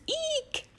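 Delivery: squeaky high mock squeal of fright; tiny hammed-up shriek of fright; mock eek. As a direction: squeal it like a little girl seeing a spider. Girl scared of spiders.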